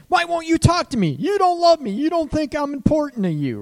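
high, loud screamed complaint; yelling, screaming. Acted. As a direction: act out a partner screaming to be heard. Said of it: "Yelling, screaming".

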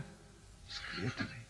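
slow, hushed whisper; awed whisper. Retold or imitated. Soldier realising there is one God, awed whisper. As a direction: drop to a bare whisper.

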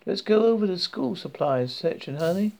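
lightly voiced Southern woman; friendly Southern accent. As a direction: sweep her along with Southern charm. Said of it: "speaking in a friendly southern accent".

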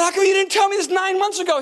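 fast, high-pitched whining protest; comically aggrieved squeal; mock indignation. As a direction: complain to God in a hammed-up high rush. Indignant retort to God.

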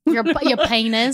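penis said with a Texas twang, "pʰaɪnəs". Acted. Texas twang, teasing her friend on air. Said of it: "I like that you put a little bit of a twang".

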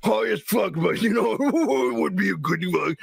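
stoned, laughing goofball impression; Seth Rogen. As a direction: play a giggly stoner pitching an idea. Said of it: "Is that what Seth Rogen really did?".

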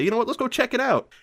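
high, quick, eager mock couple voice; eager suggestion. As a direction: toss it off brightly like a keen teen. Couple decide to investigate.

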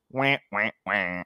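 soft, falling wah-wah; sad trombone imitation. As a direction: imitate a comic letdown sting. Mimics a comic letdown sting.

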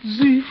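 sharp high grunt of strain; tugging hard at tight breeches; effort grunt. Acted. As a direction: squeeze out a loud straining noise. Straining to pull on tight pants.

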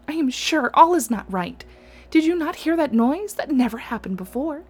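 uneasy, insisting something is wrong; nervous princess. Acted. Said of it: "made the youngest sister tremble with fear".

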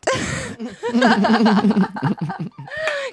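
high burst of laughter. Real, not acted. Laughing at own story.

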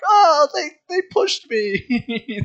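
high, slow whimpering victim; exaggerated babyish complaint; mock tattletale. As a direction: wail it like a fake-hurt kid.

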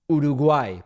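Uruguay as oo-doo-GWAI, with a tapped r; punchy Spanish Uruguay, ending on GWAI; Spanish pronunciation. Retold or imitated. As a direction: say Uruguay like a native Spanish speaker. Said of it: "Spanish pronunciation".

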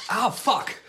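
sudden loud yelp of pain; pained shout. Real, not acted. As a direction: yell as it stings you. Zapped by the bug zapper.

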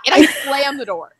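ordinary delivery

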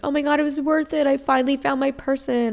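high, gushing imitation of giddy relief; lightly hammed-up joy, pitched high; mock elation. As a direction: play the imagined person who finally made it. Imagined future relief and joy.

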